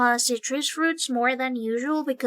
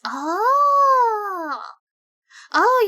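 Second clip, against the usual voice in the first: drawn out, higher oh; surprised oh; 8 semitones higher, 5 dB louder than usual. Real, not acted. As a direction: realize something suddenly. Sudden realisation.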